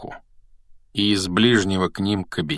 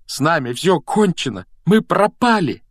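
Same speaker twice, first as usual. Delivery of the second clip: high, louder panicked wail; despairing moan; 9 semitones higher, 4 dB louder than usual. A narrator's reading. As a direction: wail it, rising to the last word. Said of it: "простонал Рон". (в отчаянии, всё выше и громче)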